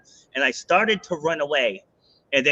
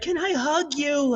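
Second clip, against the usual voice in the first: much higher, slow, teary plea; imitating her crying; 12 semitones higher than usual. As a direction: mimic the tearful racer asking for a hug. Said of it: "Now she's bawling".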